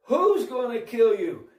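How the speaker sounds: high, loud, drawn-out retold outburst; alarmed king's outburst. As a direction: jump up and demand it as the outraged king. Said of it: "he jumped up and said".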